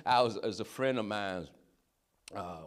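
usual speaking voice

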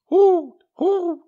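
very high, loud hooting owl; owl call. A narrator's reading. Owl calling out in the dark, loud hoots. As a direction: hoot like an owl in the night. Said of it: "an owl called out".

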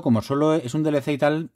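normal talking voice

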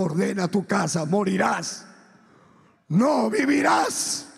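forceful decree rising to a shout; voice of God. Retold or imitated. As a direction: pronounce judgment, building to a drawn-out cry. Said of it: "Dios me dijo".

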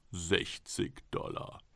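whispered, slow price offer; hushed bid. Acted. Golem's buyer whispering his falling bid. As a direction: breathe the number out slowly. Golem's man bids quietly.

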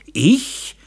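high-pitched surprised question; astonished. A narrator's reading. Daughter answers her father's accusation, astonished. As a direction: act amazed to be accused. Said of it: "fragte das Mädchen staunend".

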